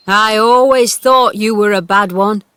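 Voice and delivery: put-on loud, cross old-man scolding; cross old gardener. A narrator's reading. Gardener scolding the girl over the wall, crossly. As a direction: tell the girl off sharply from the wall. Ben scolds Mary from ladder.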